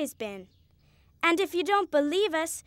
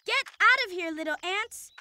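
a sharp, higher yell to drive them off; angry shout; 4 semitones higher than usual. Acted. Girl chasing ants from her snack, angry shout.

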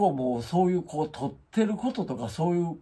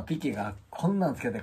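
ordinary talk

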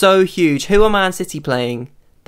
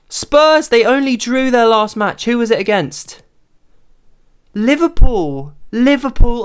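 higher and louder, buzzing with glee; thrilled news breaking out; gleeful high commentary on the result; excited; 6 semitones higher, 4 dB louder than usual. Real, not acted. Reacting to rival's draw.

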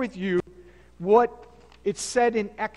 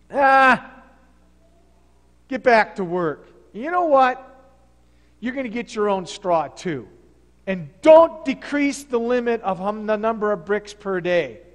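loud, gruff scolding order; harsh Pharaoh; 8 dB louder than usual. Retold or imitated. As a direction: bark at the slaves like a tyrant. Pharaoh scolds the enslaved people.

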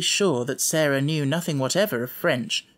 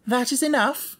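high, curt and tart; polite tartness; 10 semitones higher than usual. A narrator's reading. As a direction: cut her off politely but sharply. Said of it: "with polite tartness".

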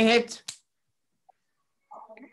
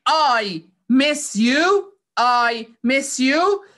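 I miss you stretched out slowly, word by word; slow teaching demo; much slower than usual. Shown as a demonstration. Teacher modelling the phrase for children: very slow and clear. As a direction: spell it out slowly for the kids. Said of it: "مس معناها لما واحد يفتقد".

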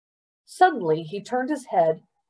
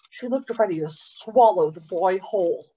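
ordinary delivery, nothing marked

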